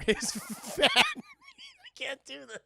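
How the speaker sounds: high, slow laugh-choked plea; cracking up. Real, not acted. Streamer cracks up reading the fan fiction to chat. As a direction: give up through laughter. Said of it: "breaks up laughing".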